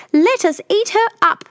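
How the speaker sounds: very high squeak; squeaky tiny bear. Acted. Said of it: "Tiny Bear squeaked".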